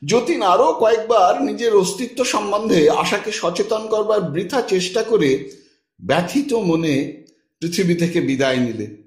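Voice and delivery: ordinary delivery, nothing marked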